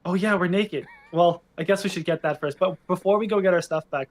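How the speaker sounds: ordinary delivery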